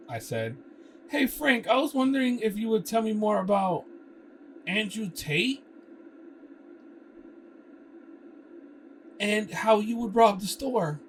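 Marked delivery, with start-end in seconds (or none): 1.1-11.0 high, loud, overly casual; eager questioning. Acted. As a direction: fish for details. Prying Frank about robbery plan.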